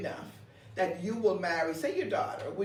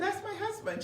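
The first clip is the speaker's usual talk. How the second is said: excited claim; 13 semitones higher than usual. Retold or imitated. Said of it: "I said".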